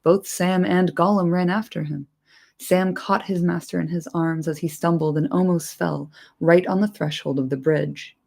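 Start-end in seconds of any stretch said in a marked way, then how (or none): none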